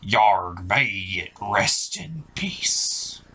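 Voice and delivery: ordinary talk, nothing marked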